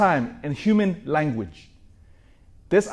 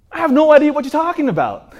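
much higher and louder, panicked; frantic denial; 12 semitones higher, 8 dB louder than usual. Acted. Peter to the second accuser, a loud frantic denial. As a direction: blurt it out to get away.

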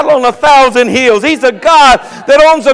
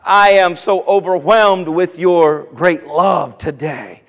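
hushed sample prayer to the Lord; demonstrating prayer; 4 semitones lower than usual. Shown as a demonstration. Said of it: "Things like".

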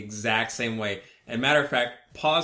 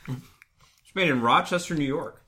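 louder, slow, stress on made; louder repeat; 4 semitones higher, 6 dB louder, slower than usual. Real, not acted. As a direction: repeat it slowly so they hear. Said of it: "where's it made again".

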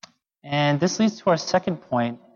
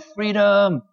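higher-pitched, restrained imitation of a battle yell; mock heroic yell; 6 semitones higher than usual. Preacher quotes William Wallace's final words in Braveheart, half-hearted yell. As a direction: call it out like a film hero, without full volume. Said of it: "and he yelled out".

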